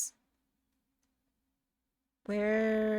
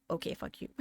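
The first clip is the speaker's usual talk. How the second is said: very soft, half-hearted retort; meek compliance; 11 dB quieter, faster than usual. Retold or imitated. Too-nice streamer obeying her friend, meek and soft. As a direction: imitate yourself obediently cursing, barely audible. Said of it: "And I go".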